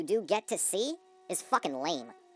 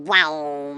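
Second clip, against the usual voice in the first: loud, drawn-out "wowmm" like a bass drop; imitated bass drop; 12 dB louder than usual. Critic mimics the crappy reverb bass, loud booming imitation. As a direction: boom it out as a mock suspense sting. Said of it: "it's that crappy reverb bass".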